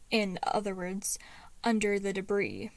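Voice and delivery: ordinary delivery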